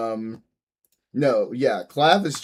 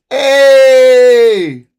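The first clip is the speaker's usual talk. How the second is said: sudden high yell, much louder; loud shout; 11 semitones higher, 20 dB louder than usual. Real, not acted. Calling out loudly to the stream to grab attention. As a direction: yell hey at full volume.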